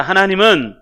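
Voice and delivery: loud declaration for repeating; firm, ringing group-prompt delivery; lead a repeat-after-me. Shown as a demonstration. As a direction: proclaim it in full voice so all can echo. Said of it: "한번 따라합니다".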